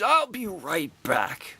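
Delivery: a hint of the star excusing herself again; imitating Carrie Fisher. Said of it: "And she's".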